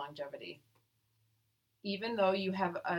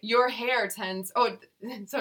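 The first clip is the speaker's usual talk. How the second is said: laughing; 5 semitones higher, 8 dB louder, slower than usual. Real, not acted. Still laughing after the cheer.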